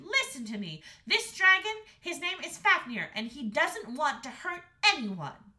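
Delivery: put-on high, loud earnest girl; insistent Astrid. A narrator's reading. Daughter defending the dragon to the parents, insistent. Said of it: "said Astrid".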